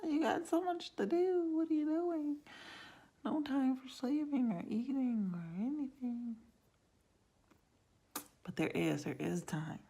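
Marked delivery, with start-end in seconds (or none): 0.1-6.4 much higher, frantic voice of her own brain; panicked inner voice. Retold or imitated. Her brain reacting to the packed planner, high and panicked. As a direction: play your stressed-out brain, pitched way up. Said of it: "my brain's like".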